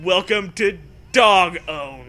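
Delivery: loud, slow announcer voice. Acted. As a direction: boom it like a show host. Announcer parody.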